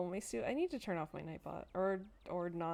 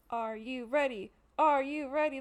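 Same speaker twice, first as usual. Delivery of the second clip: excited build-up; 4 semitones higher, 9 dB louder than usual. Real, not acted. Hyped anticipation building.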